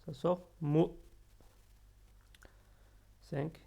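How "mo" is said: MO, vowel halfway between o and ou. Shown as a demonstration. Learners given the happy medium, MO with in-between vowel. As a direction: say MO short, midway between "mou" and "mo". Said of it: "il faut trouver un juste milieu entre mou".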